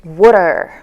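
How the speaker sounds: "water" with a tapped t, like "wudder"; reduced "what are". Shown as a demonstration. Teacher reveals favorite tip, linked "water". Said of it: "The last tip here".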